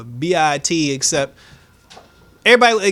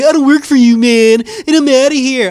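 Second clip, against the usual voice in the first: much louder, higher Jason quote; mock Jason storming off; 8 semitones higher, 8 dB louder than usual. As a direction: bark it like an angry teen. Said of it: "He's like".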